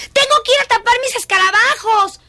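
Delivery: high, loud burst of alarm; urgent exclamation. Acted. Girl remembers beetles before rain, urgent exclamation. Child rushes to cover beetles.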